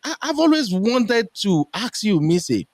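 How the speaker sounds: high, fast, astonished rush; amazed exclamation. Retold or imitated. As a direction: gush out in disbelief. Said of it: "I'm like".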